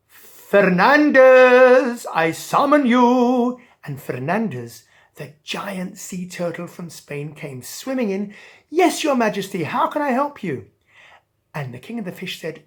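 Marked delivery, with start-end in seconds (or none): 0.1-3.6 loud, very slow drawn-out name; royal summons. Acted. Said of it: "and called". 8.7-10.7 higher, louder keen servant; a hint of a willing turtle; eager turtle. Turtle answering the king.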